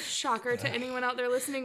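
ordinary delivery

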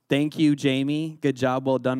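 usual speaking voice

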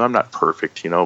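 ordinary delivery

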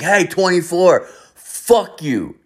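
high-pitched taunting call; retold heckle. Kid chirping at number twenty-four, retold heckle. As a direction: call out the insult, pitched well up.

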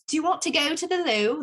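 high-pitched put-on British twang; British accent. Student home from England copying the tour guide, high British accent. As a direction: imitate a British lilt, pitched well up. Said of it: "with the little twang in our voice".

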